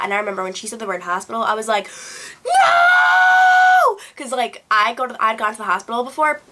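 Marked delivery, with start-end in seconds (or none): 2.4-4.0 long, piercingly high acted scream; screaming and crying. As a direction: wail it out loud, re-enacting terror. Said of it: "So I started screaming and crying".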